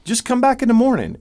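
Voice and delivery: high, friendly coaxing call; reassuring call. Retold or imitated. Telling campers by the gate, high reassuring call. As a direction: coax them to come back later, kindly. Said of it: "and we tell them".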